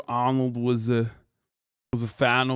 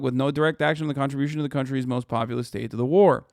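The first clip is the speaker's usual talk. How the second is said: normal talking voice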